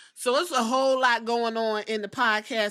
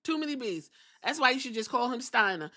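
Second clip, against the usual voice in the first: usual speaking voice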